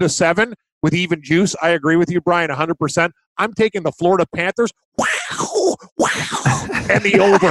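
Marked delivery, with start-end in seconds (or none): 5.0-7.5 mock wow. Mock awe at pick.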